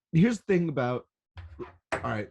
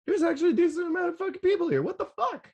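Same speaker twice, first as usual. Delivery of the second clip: high, fast squeal of shocked disbelief; stunned disbelief; faster than usual. Retold or imitated. Fan at the rail looking back at a crowd, high shocked squeal. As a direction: gasp it out like you can't believe the crowd. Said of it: "I'm like".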